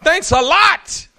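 drawn-out, high, loud mock sarcasm; sarcastic thanks. Crowd member to the bleeding woman, sarcastic and drawn out. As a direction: pour on the fake gratitude. Mock reaction to being defiled.

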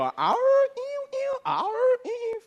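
high squealing ows and ews; mock yelps of pain. Preacher imitates wounded men, high squealing yelps. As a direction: yelp like a hurting soldier. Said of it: "All you hear go".

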